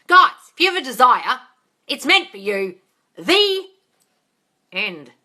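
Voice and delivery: loud, high rallying yell; shouting. Real, not acted. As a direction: belt it out to the viewers. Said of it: "That's what I'm shouting at".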